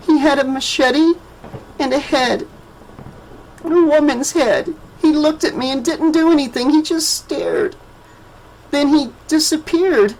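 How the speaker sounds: put-on high, hushed child voice; a scared child recalling horror; frightened little girl. A narrator's reading.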